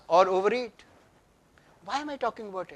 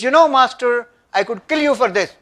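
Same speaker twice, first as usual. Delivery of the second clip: loud, raised threat from a shaking warrior; angry threat; 5 semitones higher, 8 dB louder than usual. A narrator's reading. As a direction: threaten the master in rage. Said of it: "his hand shaking, teeth clenching".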